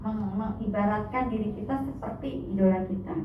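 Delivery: ordinary talk, nothing marked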